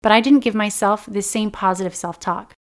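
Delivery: ordinary delivery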